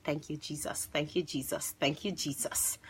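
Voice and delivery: very soft, repeated under the breath; relieved prayer. Retold or imitated. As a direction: murmur thanks privately to God. Relieved private prayer, retold.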